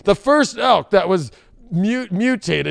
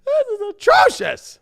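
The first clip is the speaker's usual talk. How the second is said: mock outrage; 12 semitones higher than usual. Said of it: "I was like".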